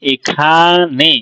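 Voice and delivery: এই খানে very slow, খা held apart from নে; syllable split. Shown as a demonstration. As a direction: stretch খানে into খা, নে. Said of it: "এখানে দেখ অক্ষর".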